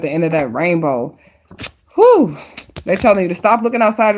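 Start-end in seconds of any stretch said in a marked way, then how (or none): 1.9-2.4 big exhale. Real, not acted. Reacts after the reading.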